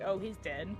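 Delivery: ordinary delivery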